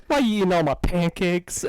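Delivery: lightly whiny nerd voice; hint of a whining nerd. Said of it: "whining nerd voice".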